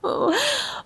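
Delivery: a high, soft laugh through tears; little high laugh, near tears; tearful laugh. Real, not acted. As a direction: laugh to stop herself crying. Laughs while nearly crying.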